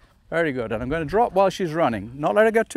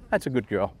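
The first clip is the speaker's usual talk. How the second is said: soft praise; 8 semitones lower, 5 dB quieter than usual. Real, not acted. Praising dog.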